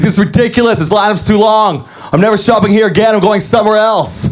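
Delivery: high-pitched mock outburst from a fed-up shopper; losing it. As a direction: imitate a customer spazzing out in the queue. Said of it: "starts spazzing, starts losing it".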